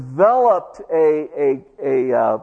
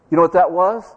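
normal talking voice

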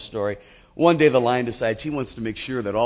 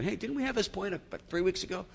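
high, soft teasing voice of a listener; high, soft mock grumble; mock congregation thought; 8 semitones higher, 7 dB quieter than usual. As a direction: play the listener's gentle complaint. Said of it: "I know you're thinking".

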